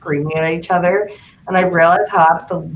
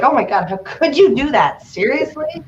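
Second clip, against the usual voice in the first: hammed-up fake outrage, Seriously rising higher; mock miffed; 7 semitones higher than usual. Teasing Joseph with a mock miffed outburst. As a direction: pretend to be upset over nothing. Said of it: "pretend to be, like, miffed".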